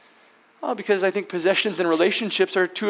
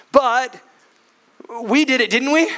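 proud Peter; 5 semitones higher than usual. Acted. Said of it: "blurting this out as a proud statement".

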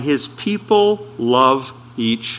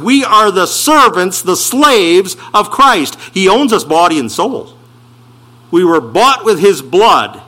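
louder, higher, driving sermon voice; preaching at full force; 5 semitones higher, 5 dB louder than usual. Acted. Preacher exhorts his church, raised and forceful. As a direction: press every phrase on the congregation.

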